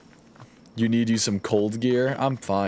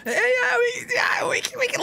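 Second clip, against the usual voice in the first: very high, drawn-up cheer; excited squeal. Real, not acted. Cheering that we can level up my attack, excited squeal. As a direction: squeal with glee over a small win.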